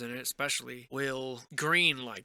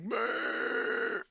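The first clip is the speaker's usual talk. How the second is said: high, drawn-out mock holler; cartoon yell at normal volume; yelling rock giant; 8 semitones higher than usual. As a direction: bleat back like a second giant. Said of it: "like, yelling at each other".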